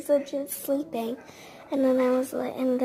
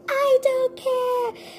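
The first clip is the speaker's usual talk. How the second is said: put-on very high, slow childish wail; mock tantrum yell; 12 semitones higher, 4 dB louder, slower than usual. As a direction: stretch it out like a kid in a fit. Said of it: "she started crying more and more".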